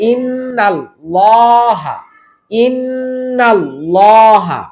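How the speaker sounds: ইন্নাল্লাহা recited slowly, the double L thick and dark; slow Quranic recitation of ইন্নাল্লাহা; heavy L in Innallaha. Shown as a demonstration. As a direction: stretch ইন্নাল্লাহা with a full heavy L.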